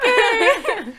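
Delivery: giggling through the words; nervous laughter. Real, not acted. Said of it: "sorry, I'm nervous".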